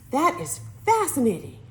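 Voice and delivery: sugary admiring coo; sweet tone. A narrator's reading. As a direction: melt like maple syrup. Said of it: "that tone of voice".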